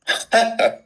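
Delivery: short, high mock laugh; a quick disbelieving "ha"; scoffing laugh. Patient told not to worry, scoffing laugh.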